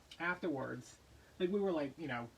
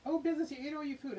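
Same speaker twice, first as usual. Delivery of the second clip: high, fast, louder voice for the pet; pet talk; 10 semitones higher, 4 dB louder, faster than usual. Real, not acted. Owner talking to her pet Business, high and excited. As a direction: coo at the pet about his empty bowl.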